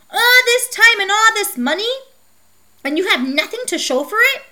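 loud angry outburst, high; furious, high-pitched scolding. A narrator's reading. Official angry at failure.

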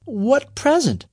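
high, slow tearful question; puzzled, high, slow, mildly tearful; holding back sobs. A narrator's reading. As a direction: ask it while fighting back sobs. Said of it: "trying to stop the series of sobs".

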